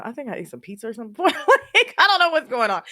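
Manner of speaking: real nervous laughter that holds back tears; nervous laughing. Real, not acted. Telling viewers about her mom, laughing nervously to avoid crying. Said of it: "I keep laughing 'cause I laugh when I try not to cry".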